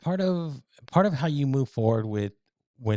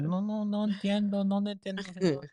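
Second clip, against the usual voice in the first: put-on higher, softer old Spanish lady; 8 semitones higher, 4 dB quieter than usual. Brushing off the caller as an old lady, high soft voice. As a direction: play dumb like a sweet old abuela. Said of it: "I'd act like I was an old lady".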